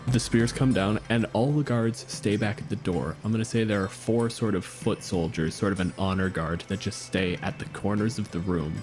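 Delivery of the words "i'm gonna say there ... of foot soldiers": ordinary delivery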